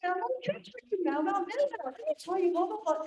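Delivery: put-on very high, eager child voice; excited little boy. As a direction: imitate a grandson bursting onto the call. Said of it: "he came running in and goes".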